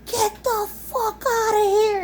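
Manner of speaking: high, slow mock yell at the raccoon; hammed-up yell. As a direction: impersonate hubby chasing it off, pitched well up and drawn out. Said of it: "And he's like".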